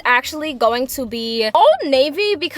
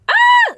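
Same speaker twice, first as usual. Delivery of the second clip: startled yelp; 11 dB louder than usual. Real, not acted. Startled by loud audio level.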